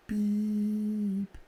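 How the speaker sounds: a high, thin mimicked beep; imitated beep. Retelling the son's story of the alarm, a high mimicked beep. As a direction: chirp it like a movie machine. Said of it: "Just goes".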